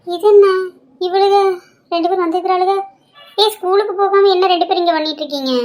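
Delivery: taken aback and scolding; startled scolding. Acted. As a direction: demand an explanation from truant children. Aunt finds girls skipping school.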